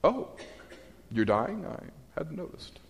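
soft deadpan sarcasm, trailing to near nothing; understated mock indifference; dry sarcasm. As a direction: feign surprise, then shrug it off. Sarcastic reply, voiced figure.

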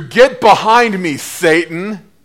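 loud, slow, commanding rebuke; stern rebuke. Acted. Jesus rebuking Peter, loud slow command. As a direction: cast him off with force.

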